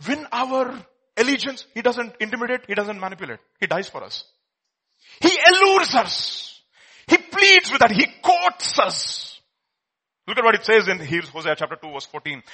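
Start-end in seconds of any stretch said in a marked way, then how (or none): 5.2-9.4 high, loud, stretched-out preaching cry; impassioned proclamation. Real, not acted. Preacher tells how God wins allegiance, impassioned loud proclamation. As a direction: draw each verb out at full force.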